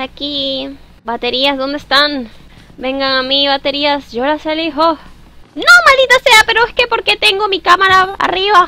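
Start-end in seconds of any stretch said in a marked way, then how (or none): 5.5-8.7 sudden frustrated yell; loud outburst. Real, not acted. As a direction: blurt it out, annoyed and loud. Startled gamer outburst.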